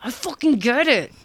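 fed up and venting at the book; exasperated. Retold or imitated. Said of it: "There was a whole middle that I'm like".

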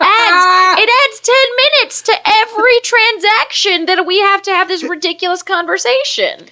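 high, exasperated complaint; exasperated rant. Real, not acted. Comic complaining about the cab-fare argument, exasperated. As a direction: push it up high in mounting exasperation.

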